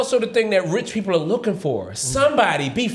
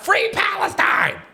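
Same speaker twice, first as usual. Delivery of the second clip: high, drawn-out mock yell; retold heckler yell; 12 semitones higher, slower than usual. Lady heckles the comic from the crowd, high mock yell. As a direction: imitate a heckler calling out in a high voice. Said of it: "this lady just yells out".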